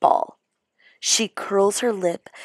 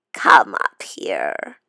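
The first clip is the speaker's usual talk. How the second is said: sinister beckoning; 12 semitones lower, 3 dB louder, slower than usual. Acted. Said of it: "she beckons with her claw".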